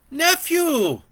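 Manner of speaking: high, loud joyful shout; joyful cry. Acted. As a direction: cry out his name in delight. Auntie cries out seeing nephew.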